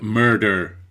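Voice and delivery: slow, overdone American "murder" with heavy rolled-back R; exaggerated American R. Shown as a demonstration. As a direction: drag out "murder" with a thick American er sound. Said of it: "that was over-exaggerated on purpose".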